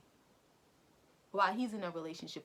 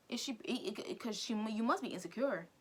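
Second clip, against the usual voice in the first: ordinary talk, nothing marked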